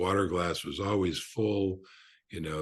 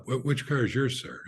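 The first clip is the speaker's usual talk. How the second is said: lightly voiced polite young valet, higher pitch; 6 semitones higher than usual. Valet to a restaurant guest, lightly voiced polite inquiry. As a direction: ask politely, pitched up a little. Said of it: "this young man comes up".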